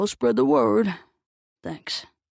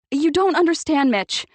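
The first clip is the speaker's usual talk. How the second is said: higher and louder shout; 7 semitones higher, 7 dB louder than usual. A narrator's reading. Octavia shouting to Mitch while running across the street. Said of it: "she shouted".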